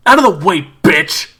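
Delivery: loud, high snarl; angry shout. Acted. David yelling at June to move, loud and angry. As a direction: bark her out of the way.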